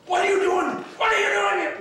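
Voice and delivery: very high, loud screamed demand; furious Ralph imitation. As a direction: imitate a boss screaming inches from a face. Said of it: "Ralph was just furious about something".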